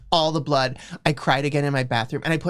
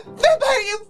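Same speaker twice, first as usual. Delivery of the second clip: high, squealing insult; mock angry shriek; 3 dB louder than usual. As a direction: shriek it while slapping him. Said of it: "I said, slapping him".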